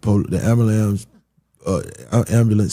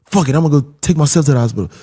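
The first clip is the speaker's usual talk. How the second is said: fast, higher, louder angry rant; retold fed-up rush; angry outburst; 6 semitones higher, 4 dB louder, faster than usual. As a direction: storm off fed up. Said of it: "I get mad".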